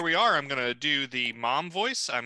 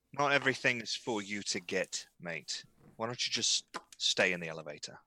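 low, softened in-character dismissal; understated threat, trailing down in pitch; quiet warning; 6 semitones lower, 7 dB quieter than usual. Acted. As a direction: drop low and softly warn him off.

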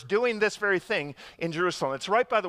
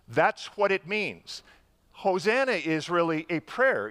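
usual speaking voice

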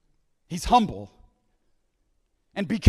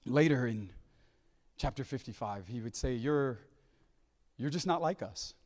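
low, soft reverent address to God; hushed scripture recitation; 7 semitones lower, 8 dB quieter than usual. Acted. Preacher voicing Isaiah saying God is not like us, hushed reverence. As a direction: speak each line gently, pausing after it.

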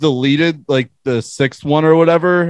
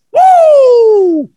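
high, loud party whoop; frat bro whoop; 10 dB louder than usual. Real, not acted. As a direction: cheer like a frat bro at a keg stand. Cheer after beer chug.

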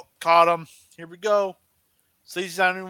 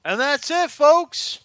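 high, louder commentator sign-off; wrestling commentator sign-off; 9 semitones higher, 5 dB louder, slower than usual. Pretend. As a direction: close the show like an announcer. Signing off like a commentator.

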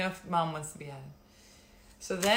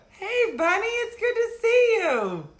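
high, loud delighted hello; excited greeting; 9 dB louder than usual. Real, not acted. Greeting a friend joining live.

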